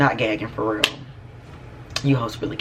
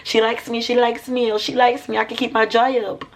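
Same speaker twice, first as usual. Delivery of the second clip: high-pitched mock gushing; gushing mimicry; 12 semitones higher, 3 dB louder than usual. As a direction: squeal it in a high sing-song, imitating someone thrilled. Mocking someone's gushing delight in retelling.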